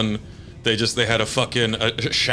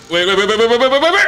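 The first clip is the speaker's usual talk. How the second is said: rising, louder frantic waits; panicked shouting; 10 semitones higher, 6 dB louder, much faster than usual. Real, not acted. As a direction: panic as it slips away. Panicked game moment.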